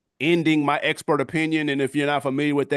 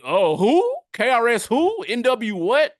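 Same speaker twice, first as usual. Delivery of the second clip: high, rising puzzled questions; mock clueless; 8 semitones higher, slower than usual. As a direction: act baffled, as if never having heard the names. Mimics someone ignorant of rap pioneers.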